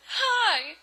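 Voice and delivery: high, loud, chirpy hello; hammed-up cheerful hail; perky greeting. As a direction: greet with overdone eagerness. Eager greeting, contrasted with sad reply.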